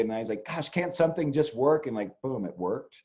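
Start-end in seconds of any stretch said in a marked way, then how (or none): 0.4-1.9 retold frustration. Astrologer retelling a project that kept needing redoing, exasperated. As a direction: replay a fed-up complaint, higher voice. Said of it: "I got really angry and really frustrated".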